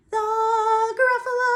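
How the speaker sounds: high sung call; high loud Gruffalo. Shown as a demonstration. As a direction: belt the high note.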